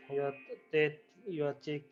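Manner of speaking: usual speaking voice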